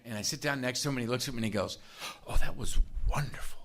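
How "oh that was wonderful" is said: imitated whisper of rapt admiration; awed gushing whisper. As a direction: whisper it as if deeply moved. Sponsor gushes over his own line.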